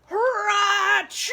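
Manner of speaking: very loud, high booming sneeze; huge sneeze. Acted. Bear sneezing from the pepper, huge and loud. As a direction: explode into a giant sneeze. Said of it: "makes the bear".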